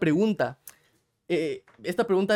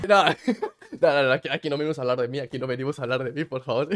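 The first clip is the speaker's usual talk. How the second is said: quick laughing no, no, no; laughing deflection; 4 semitones lower, faster than usual. Real, not acted. Host laughing off the guest's question, quick and giggly. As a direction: brush it off with a laugh, fast.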